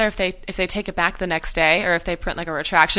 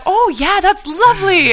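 high gushing folksy voice, a touch louder; mock gushing Palin; 12 semitones higher, 6 dB louder than usual. Retelling Palin's gushing reply to the prank, in a high mock folksy voice. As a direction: gush it like an eager Palin. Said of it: "And she's like".

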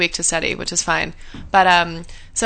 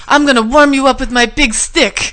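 mock Brazilian professor, higher and louder; hammed-up accented threat; playful imitation of the professor with a Brazilian accent; 5 semitones higher, 5 dB louder than usual. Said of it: "thick Brazilian accent".

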